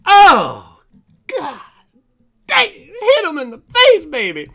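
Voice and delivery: real, high, loud burst of triumph; excited shout. Real, not acted. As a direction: cheer like a knockout punch landed. Another big pull.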